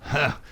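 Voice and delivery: single sharp mock laugh. A narrator's reading. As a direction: bark out one short laugh. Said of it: "McGinty laughed".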